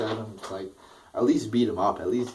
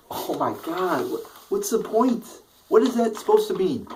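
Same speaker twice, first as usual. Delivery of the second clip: an incredulous rant at the booking; exasperated outburst; 10 semitones higher, 4 dB louder than usual. Real, not acted. Reviewer fuming at Ambrose's pointless segment, exasperated and high. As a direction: pitch shooting much higher in disbelief, louder by the end.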